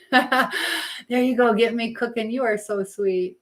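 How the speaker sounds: ordinary talk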